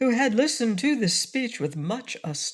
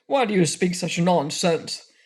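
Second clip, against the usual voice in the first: angry. A narrator's reading. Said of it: "asked the frogman angrily".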